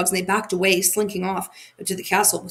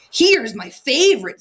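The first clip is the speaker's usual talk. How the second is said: higher, louder and slow; eager; 5 semitones higher, 5 dB louder than usual. A narrator's reading. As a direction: show off the favourite. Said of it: "said the robber girl".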